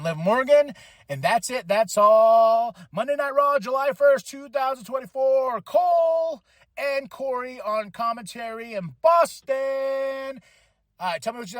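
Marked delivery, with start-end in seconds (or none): 1.1-2.7 catchphrase sign-off. Real, not acted. Outro catchphrase again. 2.9-10.4 big hammed announcer shout; announcer callout.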